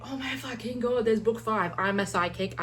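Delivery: retold amazement. Reader retelling finding book five, acted amazement. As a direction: replay the thrill of a big find.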